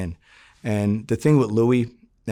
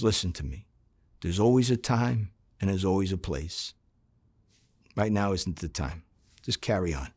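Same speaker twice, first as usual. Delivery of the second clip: softer, hushed retelling of a warning; imitated whisper; 3 semitones lower, 7 dB quieter than usual. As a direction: pass on a low, secretive warning. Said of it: "And he starts whispering".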